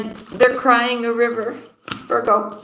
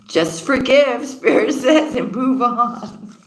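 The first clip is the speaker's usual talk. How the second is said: slow, higher words broken by laughter; laughing; 4 semitones higher, slower than usual. Real, not acted. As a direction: draw it out through giggles. Laughing while relaying spirit's advice.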